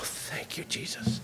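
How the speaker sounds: breathy drowsy whisper; whispered thanks. Retold or imitated. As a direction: whisper it in sleepy relief. Drowsy relief from cough syrup.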